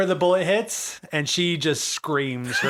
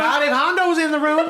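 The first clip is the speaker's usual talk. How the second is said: barista voice; 10 semitones higher than usual. Acted. GM voicing barista, scolding.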